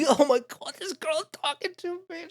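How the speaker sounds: high, squeaky mock gushing; starstruck boy. Boy thinking about the girl talking to him, high starstruck squeak. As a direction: imitate an awed, flustered boy. Mimicking boy thrilled by girl's attention.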